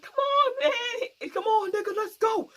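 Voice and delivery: high-pitched, hammed-up hurry-up cry; mock urgent calling. Friend while everybody started running, mock urgent calling. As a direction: imitate someone frantically urging a friend to flee. Retold urging everyone to run.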